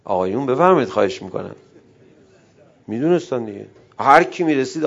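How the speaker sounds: ordinary talk, nothing marked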